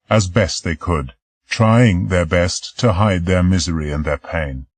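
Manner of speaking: usual speaking voice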